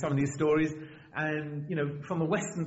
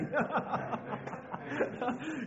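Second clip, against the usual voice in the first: high giggle; real laughter; 9 semitones higher, 6 dB quieter than usual. Real, not acted. Preacher tickled by the catwalk walk, giggling. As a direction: crack up at your own joke. Amused at own joke.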